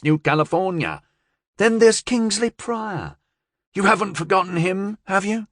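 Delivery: high-pitched woman briskly reassuring her boss; confident gangster's partner. A narrator's reading.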